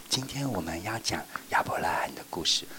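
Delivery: soft whisper; teacher whispering to children. Shown as a demonstration. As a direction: open the story in a whisper. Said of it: "我一定说".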